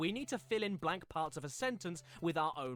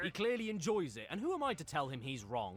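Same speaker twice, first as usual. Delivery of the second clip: ordinary talk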